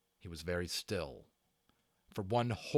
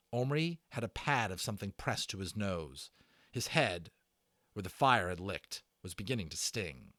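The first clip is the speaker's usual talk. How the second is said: plain reading voice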